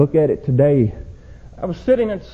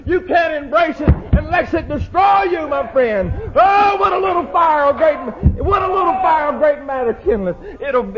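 ringing pulpit shout, voice raised high; fiery preaching; 11 semitones higher than usual. Acted. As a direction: preach it at full force to the congregation. Preacher at full force.

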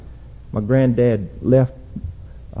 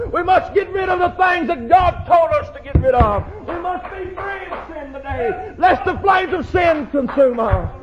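ringing pulpit shout, voice raised high; fiery preaching; 12 semitones higher than usual. Acted. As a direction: preach it at full force to the congregation. Preacher builds to full force.

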